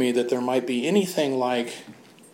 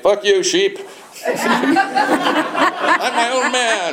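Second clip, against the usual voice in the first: mock drunken defiance; 11 semitones higher, 4 dB louder, slower than usual. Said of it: "I'd be like".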